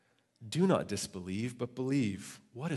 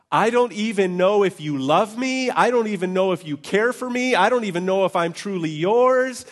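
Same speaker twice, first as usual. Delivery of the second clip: higher, louder pleading cry; desperate prayer; 9 semitones higher, 10 dB louder than usual. Retold or imitated. Preacher reliving his desperate night prayer to God. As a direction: plead as if at the end of your rope. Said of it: "praying a prayer of".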